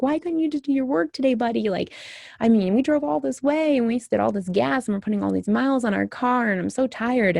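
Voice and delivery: put-on a worn-out mom pleading with her boy; upset plea. Mother in the car to her son, upset and pleading. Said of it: "and I was upset".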